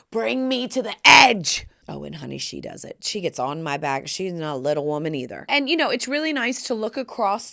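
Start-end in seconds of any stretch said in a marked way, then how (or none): 0.1-1.7 loud, slow, hammed-up cry; dramatic demand. Urging the masseuse on, hammed-up loud demand. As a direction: build to a drawn-out shout.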